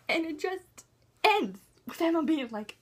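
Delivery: ordinary talk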